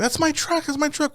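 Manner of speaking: high, whiny imitation of the girl; high mock whine. Girl protesting the truck seizure, high whiny mock voice. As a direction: squeal it like a panicked girl. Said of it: "the girl's like".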